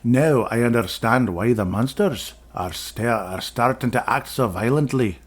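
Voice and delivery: slow, light Scottish owl voice; a hint of a wise old Scottish owl; mock Scottish accent. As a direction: read the owl's lines in a half-hearted Scottish accent. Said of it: "Does that not sound Scottish?".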